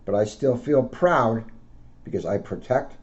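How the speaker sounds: gentle storybook read-aloud; a police officer reading to children. Acted. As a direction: read it out to kids like a picture book. Officer reads picture book aloud.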